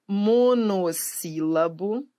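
"monossílabo" very slow, syllable by syllable; drawn-out key term. Shown as a demonstration. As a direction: stretch "monossílabo" out for the class. Teacher spelling out a key term.

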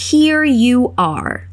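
here you are, drawn out very slowly; slow model. Shown as a demonstration. Teacher modelling the reply very slowly. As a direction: say it slowly, word by word. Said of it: "nós vamos falar".

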